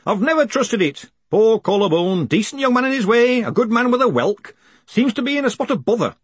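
put-on high-pitched, opinionated old academic; grumbling senior wizard. A narrator's reading.

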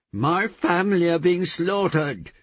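high, loud, drawn-out scream. A narrator's reading. Woman rousing a man about her slaughtered family, screaming. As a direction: scream the alarm at full pitch. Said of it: "she screamed".

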